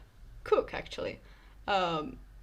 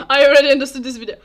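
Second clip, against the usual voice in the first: laughing; 4 semitones higher, 11 dB louder, faster than usual. Real, not acted. Laughs at the video title.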